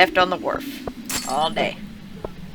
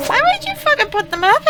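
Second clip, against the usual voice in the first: high, loud disbelieving question; high incredulous protest; 4 dB louder than usual. Retold or imitated. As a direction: argue back in disbelief. Arguing with coworker.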